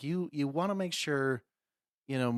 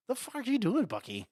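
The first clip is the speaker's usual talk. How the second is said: mock indignant; 10 semitones higher than usual. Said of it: "He's like".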